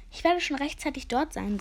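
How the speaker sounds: plain reading voice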